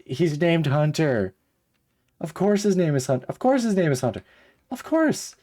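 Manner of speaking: high, loud exasperation rising to a squeak; of course his name is Hunter, dripping exasperation; exasperated rant. Real, not acted. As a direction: wail it in mock despair.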